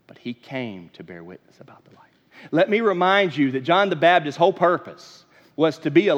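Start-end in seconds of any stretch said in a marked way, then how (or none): 0.1-2.0 hushed whisper of a reminder. Acted. Preacher reminding the church, whispered. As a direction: lean in and whisper it.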